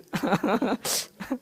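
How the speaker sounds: a light trailing laugh; soft giggle. Real, not acted. Teacher chuckling at the audience's stinginess, soft giggle. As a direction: let a small laugh rise and fade.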